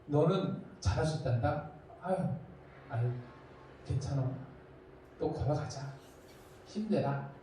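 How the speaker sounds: soft, low comforting voice; gentle encouragement. Retold or imitated. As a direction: comfort a stumbling child quietly. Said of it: "격려해주면". (부드럽고 낮게 다독이는 목소리)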